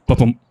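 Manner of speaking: loud, abrupt double thud; mimicked impact noise; imitated car thump. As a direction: punch out a quick roadkill bump. Imitates car hitting the possum.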